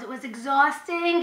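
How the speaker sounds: normal talking voice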